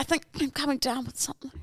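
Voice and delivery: quick embarrassed whisper; hushed apology. Pretend. Fake sick excuse whispered.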